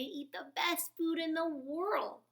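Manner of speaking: high, loud excited boast; exclaiming. A narrator's reading. Stellaluna exclaims to the birds about bat life, excited. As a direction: burst with excitement. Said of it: "Stellaluna exclaimed".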